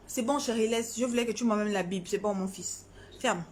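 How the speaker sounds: low, offhand parental aside. Real, not acted. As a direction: drop the voice for a hurried word to your son. Aside to child off the stream.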